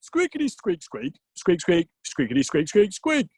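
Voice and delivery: squeak-words in a natural-pitched voice; Odin speaking squirrel. Acted. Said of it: "Oh, right, yeah, sorry, sorry".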